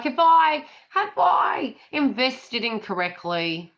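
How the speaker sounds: high, loud, drawn-out mock fretting; mock harping. Voicing listeners being hard on themselves, mock harping. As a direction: harp on it in a silly high voice. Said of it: "that's what we sound like harping on".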